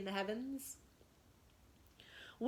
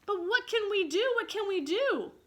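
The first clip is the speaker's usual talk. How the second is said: higher, loud panicky question; panicked; 11 semitones higher, 6 dB louder than usual. Acted. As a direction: fret in alarm. Boys panic at the wolves.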